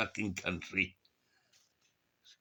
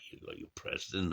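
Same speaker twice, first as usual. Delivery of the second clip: ordinary delivery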